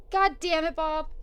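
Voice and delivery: loud, high, rattled exclamation; startled cursing. Real, not acted. Player scared by Bob's doorbell, loud high startled cursing. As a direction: curse loudly straight after a fright.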